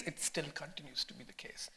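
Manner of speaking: soft unvoiced whisper; whispered aside. Real, not acted. Aside, whispered trailing remark.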